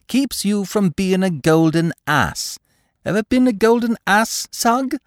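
high, lilting mockery; teasing. A narrator's reading. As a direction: needle the inspector with a grin. Peter teasing Sugg playfully.

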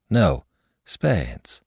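usual speaking voice

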